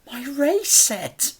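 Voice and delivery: put-on a small boy surprised; young boy. A narrator's reading. Boy learns the debt bought his race set. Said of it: "said the boy".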